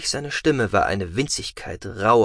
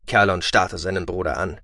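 ordinary delivery, nothing marked

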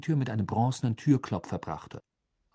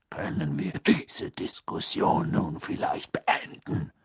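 slow, rough, voiceless growl; gruff, rasping interruption; 4 semitones lower, slower than usual. A narrator's reading. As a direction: growl it with strained patience. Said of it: "knurrte Moody".

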